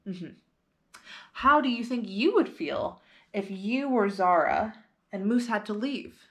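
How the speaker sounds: ordinary delivery, nothing marked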